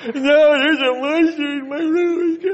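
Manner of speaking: put-on high, loud, slow whiny boy voice; insistent toddler. Son insisting to his father there is a monster. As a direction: insist like a stubborn scared toddler.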